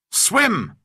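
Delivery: loud, high shouted command; cried out. A narrator's reading. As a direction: call it out sharply across the water. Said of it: "I cried".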